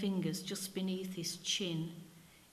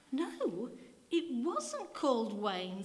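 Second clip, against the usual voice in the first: voice quavering; 4 semitones higher, slower than usual. Acted. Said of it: "his voice quavering a little".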